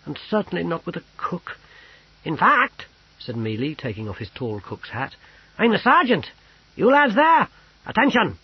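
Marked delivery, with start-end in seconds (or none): 0.0-1.6 mild sniff. A narrator's reading. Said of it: "sniffed the courtier". 2.2-2.9 lightly voiced cook. A narrator's reading. Mealy reveals himself. 5.6-8.4 loud, higher barking order; sergeant's command. A narrator's reading. Sergeant ordering the guards, loud command.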